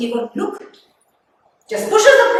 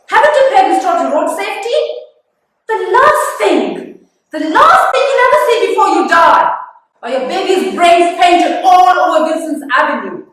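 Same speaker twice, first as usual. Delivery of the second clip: high, loud, vengeful tirade; furious scolding; 5 semitones higher, 6 dB louder than usual. Acted. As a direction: lay into the careless mother with raised voice. Imagined tirade at careless mother.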